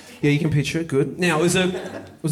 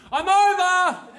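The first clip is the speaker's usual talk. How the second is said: high, loud call; announcing; 5 dB louder, much slower than usual. Retold or imitated. Calling back over the fence to the neighbour, loud and high. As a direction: sing out the news proudly over the fence. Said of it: "I announce it".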